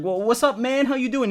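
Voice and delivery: stuttering stoned guy. Retold or imitated. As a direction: stammer out the greeting like you're way too high. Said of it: "He's, like, stuttering every fucking word".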